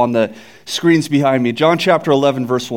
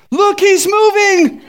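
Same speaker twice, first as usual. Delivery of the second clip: much higher, slow, drawn-out alarmed yell; startled cry; 4 dB louder, slower than usual. Acted. Mourner sees the casket move, startled yell. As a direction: shriek it like the corpse just moved. Punchline of the funeral joke.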